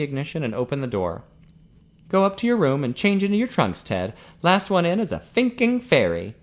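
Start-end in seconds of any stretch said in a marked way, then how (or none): 2.1-6.4 higher, lively challenge; boisterous Ian. A narrator's reading. Ian challenging Ted to race for a swim, boisterous. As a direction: playfully goad him into a race.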